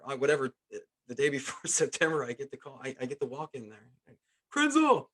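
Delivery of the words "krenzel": high, loud shout of a surname; bellowed summons; manager calling out. Retold or imitated. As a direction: holler the player's name across the room. Manager calling player into office.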